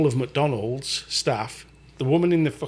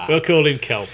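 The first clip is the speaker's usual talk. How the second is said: higher, louder, laughing repeat; 5 semitones higher, 8 dB louder than usual. Real, not acted. Host repeats the nickname to his cohost, laughing. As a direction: say it again through laughter.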